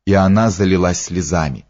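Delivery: plain reading voice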